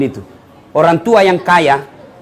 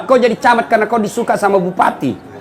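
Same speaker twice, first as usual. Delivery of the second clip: ordinary talk, nothing marked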